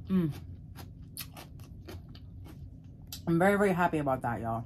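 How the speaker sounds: chewing between words; talking while eating; mouth full. Real, not acted. Seen 2: shows coleslaw cup, then fork to mouth while speaking.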